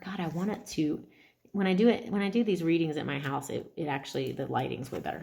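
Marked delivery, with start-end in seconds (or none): none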